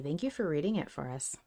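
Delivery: usual speaking voice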